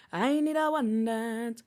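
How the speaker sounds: a lightly sung-sounding Caribbean accent impression; mock Caribbean accent. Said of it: "a fake Caribbean accent in one of his biggest songs".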